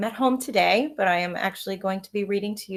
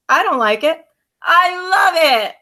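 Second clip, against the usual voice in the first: high, louder gleeful announcement; announced; 9 semitones higher, 12 dB louder than usual. Acted. Brother gleefully announcing he loves rhubarb. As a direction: proclaim it with relish. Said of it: "he announced".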